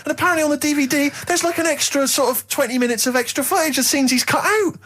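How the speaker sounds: high, whining disbelief; high, crying exasperation at the tripe; exasperated rant. Real, not acted. Ranting about extended DVD footage.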